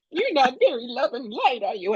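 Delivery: high, offended mock whine; hammed-up hurt reproach; upset rookie. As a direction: sulk it like a wounded healer. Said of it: "they get very upset and go".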